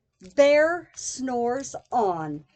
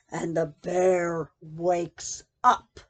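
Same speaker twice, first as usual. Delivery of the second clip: slow dramatic reveal; 9 semitones lower than usual. A narrator's reading.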